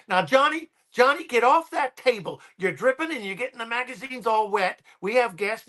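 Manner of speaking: lightly voiced mother telling son off; scolding mother. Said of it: "she goes".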